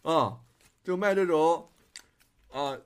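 ordinary delivery

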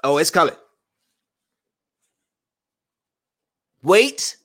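high, strained comic moans building to a laugh; mock climax. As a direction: ham up a fake climax, rising high. Mock climax bit, joking.

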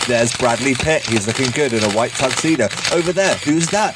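bubbly, high live red carpet host; gushing red carpet chatter. Pretend. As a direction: gush like an excited TV presenter on the carpet. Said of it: "That's a very positive, uh, intro".

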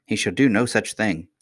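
plain reading voice

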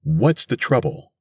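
high-pitched, anxious call from the crowd; crying out. A narrator's reading. A woman asks Mister Thurkell what is wrong, crying out. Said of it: "a woman cried".

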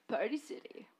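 unvoiced whisper of the name. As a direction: breathe it out as a whisper. Whispered aside.

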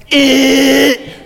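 loud, high wrong-answer buzzer; buzzer imitation. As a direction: blare it like a game-show buzzer.

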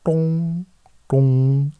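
high ding-dong chime; cabin chime imitation. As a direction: mimic the cabin bell. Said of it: "That's closer".

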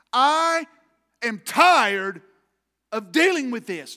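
loud, forceful cry of being fed up; exasperated outburst. Retold or imitated. Preacher acting David fed up with his trouble, loud exasperation. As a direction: hammer each word out at full volume.

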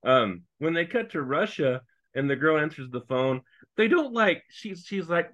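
normal talking voice

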